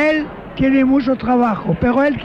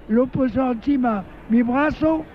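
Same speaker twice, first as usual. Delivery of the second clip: ordinary talk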